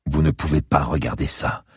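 usual speaking voice

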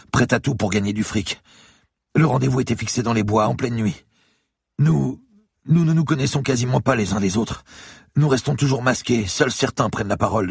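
a beaten lawyer gasping in fear; frightened bleeding man pleading with the cop; terrified captive. Acted. Lawyer recounts ritual.

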